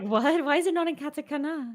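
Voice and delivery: high, loud mock astonishment; exaggerated puzzled outburst; baffled reaction. Acts out own baffled reaction.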